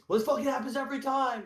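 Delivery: very high, imitated griping; exasperated complaint. Player admitting he always generalizes, high exasperated griping. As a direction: whine it out in mock exasperation.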